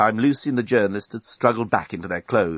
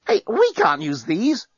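high, drawn-out protest; alarmed cry; 10 semitones higher, slower than usual. A narrator's reading. Lucy objecting to the journalist's weapons, crying out high. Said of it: "cried Lucy".

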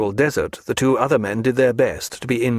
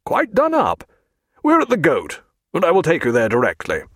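Jack, brisk; 5 semitones higher than usual. A narrator's reading. Jack to Stephen.

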